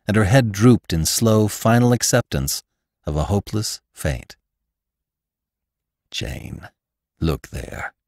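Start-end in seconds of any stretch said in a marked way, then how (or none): 6.1-7.9 hushed, broken cry of grief; a despairing near-whisper, much softer. Acted. Venters turns to Jane in despairing grief, hushed. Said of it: "cried Venters in despairing grief".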